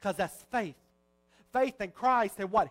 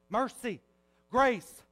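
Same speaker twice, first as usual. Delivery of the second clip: ordinary delivery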